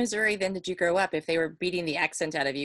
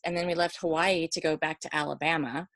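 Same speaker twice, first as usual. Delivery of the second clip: normal talking voice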